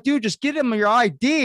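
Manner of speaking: high, loud urgent plea to the cops; retold shout. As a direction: yell it up high, pleading with the cops. Urging cops mid-arrest on boardwalk.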